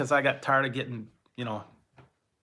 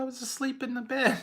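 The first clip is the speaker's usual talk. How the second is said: put-on child's reply; 11 semitones higher than usual. Child answering mother.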